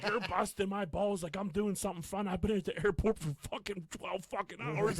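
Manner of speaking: high-pitched mock rant; imitating an angry stranger. Stranger on the phone going off at his wife, mock ranting. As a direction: whine it like a fed-up passenger. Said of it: "this one dude was just going off on his lady".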